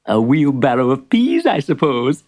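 plain reading voice

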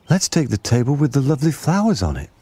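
put-on high-pitched child's suggestion; eager child. A narrator's reading. Child eagerly picks a table.

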